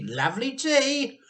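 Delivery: high, loud, gleeful urging; grinning Mister Cranky. A narrator's reading. Mister Cranky gleefully urges Grandma to drink. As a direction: egg her on with a big grin. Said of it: "grinning hugely".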